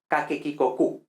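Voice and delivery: "ke, ki, ko, ku" all with a hard k; classical Latin K. Shown as a demonstration. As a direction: say "ke, ki, ko, ku" with a hard k each time. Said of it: "en latín clásico sería".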